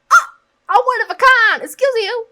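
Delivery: high, loud offended squawk; shrieked playful indignation; mock outrage. Real, not acted. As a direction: protest at full pitch, hammed-up. Mock outrage at [name]'s insult.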